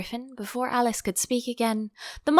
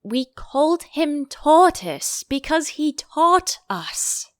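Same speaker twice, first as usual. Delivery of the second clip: slow, louder and higher, annoyed; angry; 7 semitones higher, 6 dB louder, slower than usual. Acted. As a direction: snap it out slowly. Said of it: "said the Mock Turtle angrily".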